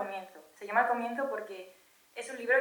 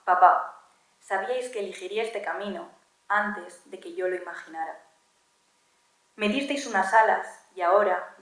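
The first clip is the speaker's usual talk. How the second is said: measured poem reading, louder on each address; poem recital; 5 dB louder than usual. Acted. Daughter reading her poem to her absent parents, poem recital. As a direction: recite it in deliberate phrases with pauses.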